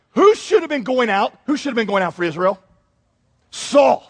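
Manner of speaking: ordinary talk, nothing marked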